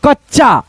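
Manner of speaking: कच्चा with the half च held briefly, no vowel after it; joined half-letter sound. Shown as a demonstration. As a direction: say कच्चा as kach-chaa, two joined syllables. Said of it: "मैं बताता हूँ".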